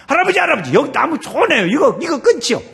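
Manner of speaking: high, loud acted child calling; eager grandson. Grandson urges grandfather to cut a good tree, high loud pleading. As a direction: call out excitedly to grandfather. 손자가 또 할아버지한테 얘기하는 거예요.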